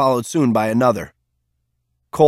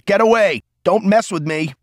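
high, loud defiant yell; raised, defiant shout into the dark; 8 semitones higher, 4 dB louder than usual. A narrator's reading. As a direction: yell it out at imaginary enemies. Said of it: "yelling at the rocks and the sky".

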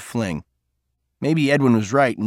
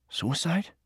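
hushed, stunned echo, softer; shocked; 5 semitones higher, 9 dB quieter than usual. A narrator's reading. Cole stunned by news about Peter, soft shocked echo. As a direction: catch your breath on the word. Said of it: "Cole caught his breath".

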